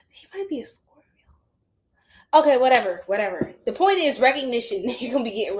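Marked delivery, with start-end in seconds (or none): 0.1-1.0 high, whispered aside. Real, not acted. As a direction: whisper it like a secret.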